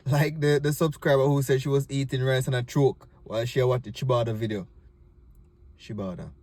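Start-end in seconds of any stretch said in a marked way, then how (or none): none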